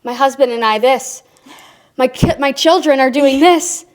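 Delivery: rising to high, loud complaint; exasperated vacationer. Retold or imitated. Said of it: "they'd be like".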